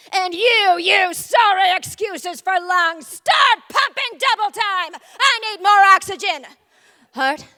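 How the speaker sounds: loud barking army general; drill general. Acted. Adrenaline ordering the body's troops like a general, loud and bossy. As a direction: command the troops like a war-movie general. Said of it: "stomping onto the scene like a general to a battlefield".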